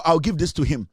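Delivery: ordinary delivery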